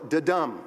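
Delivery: "da-dum" with a light da and a stressed dum; stress on second syllable. Shown as a demonstration. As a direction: lean on the "dum" in "da-dum". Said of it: "an emphasis on the second syllable".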